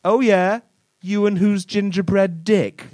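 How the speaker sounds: a lightly voiced jeering sneer; cruel taunt. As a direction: taunt him about his missing drawing. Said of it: "say something cruel like".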